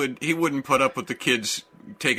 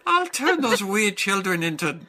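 higher, lilting Irish threat; magical Irishman; 4 semitones higher than usual. Acted. Magic mascot threatening the cereal thieves, Irish accent. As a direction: threaten a spell in a light Irish voice. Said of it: "he's magic".

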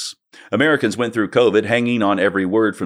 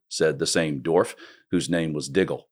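ordinary talk

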